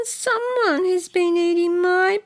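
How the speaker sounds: high squeaky little bear voice; bear cub. Acted. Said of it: "said the little wee bear".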